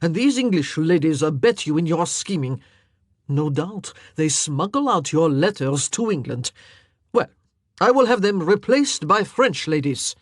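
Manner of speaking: growled. A narrator's reading. King accusing the queen, growled loud. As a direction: growl it at her. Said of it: "he growled".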